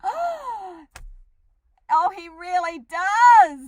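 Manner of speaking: high, slow, loud delighted oh. Real, not acted. Host delighting that the tadpole idiom fits, slow and loud. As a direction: savour the agreement with glee. Delighted agreement after gasp.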